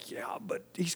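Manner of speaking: whispered, under the breath; whispered aside. Real, not acted.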